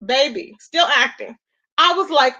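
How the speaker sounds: normal talking voice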